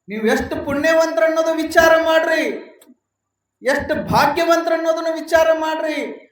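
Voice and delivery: loud impassioned sermon, pushing each line; preaching at full force. Acted. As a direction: rouse the crowd with a loud, pleading preach. Preacher shouting at audience.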